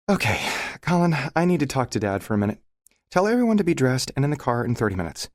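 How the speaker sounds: lightly voiced bossy wife; a hint of a brisk mother giving orders. A narrator's reading. Emma voiced by male narrator.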